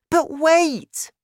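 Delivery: put-on very high, loud child's voice; young son interrupting. A narrator's reading. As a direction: cut in on Dad as a small boy. Boy tries to interrupt his father.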